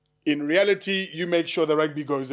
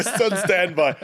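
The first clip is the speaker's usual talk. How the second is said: laughing; 4 semitones higher than usual. Real, not acted. Repeats joke while laughing.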